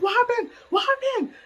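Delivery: high, urgent questioning; retold alarmed mother. Mom hearing daughter go crazy, high alarm. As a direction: imitate a panicked mom asking.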